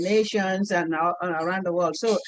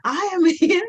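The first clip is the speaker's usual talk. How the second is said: son laughing; 6 semitones higher, 3 dB louder than usual. Retold or imitated.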